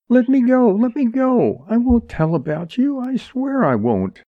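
frantic high-pitched pleading; high wailing plea to be released; crying out. A narrator's reading. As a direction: beg desperately, voice way up high. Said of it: "cried Jerry".

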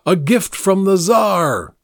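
shouted proclamation. A narrator's reading. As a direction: proclaim it to the crowd, drawn out. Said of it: "Vladimir shouted".

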